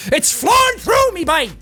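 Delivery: high, excited shout to the lads; hyped-up shout. Acted. Streamer boasts his Irishness is growing, hyped shout. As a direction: cry it out high and triumphant.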